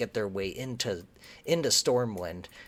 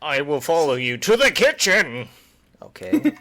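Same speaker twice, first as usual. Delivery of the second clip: hammed-up loyal paladin; 6 semitones higher, 11 dB louder than usual. Mocking the hero's overdramatic loyalty.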